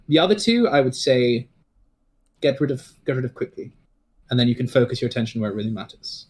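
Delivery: usual speaking voice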